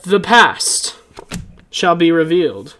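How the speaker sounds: loud mystical fortune teller; grand proclamation. Acted. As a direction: proclaim it to the crowd.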